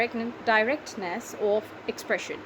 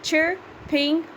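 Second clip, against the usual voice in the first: CHIRPING as two slow, raised beats; syllable by syllable; 5 semitones higher than usual. Shown as a demonstration. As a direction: split "Chirping" into chir and ping. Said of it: "Can you count how many are they?".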